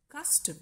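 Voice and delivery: CUS-tom, first syllable high, second shortened; stress on first syllable. Shown as a demonstration. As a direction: stress "cus" in "custom", keep "tum" short. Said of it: "it is the first syllable".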